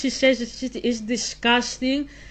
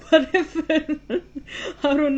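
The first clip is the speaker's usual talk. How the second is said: laughing; 5 semitones higher, faster than usual. Real, not acted. Laughing at father's remark.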